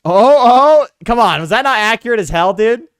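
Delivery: high, booming self-congratulation; loud mock protest. Real, not acted. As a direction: crow over your own joke impression. Boasts about his joke impression.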